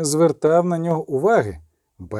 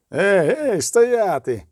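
higher-pitched call to stop; calling a halt; 4 semitones higher, much slower than usual. A narrator's reading. Knight calls out to halt them.

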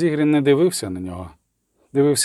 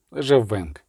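ordinary delivery, nothing marked

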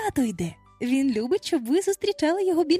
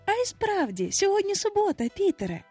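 high voice, easing down at the end; higher, yielding agreement; giving in; 10 semitones higher than usual. A narrator's reading. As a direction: concede gently and lightly. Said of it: "поступаючись".

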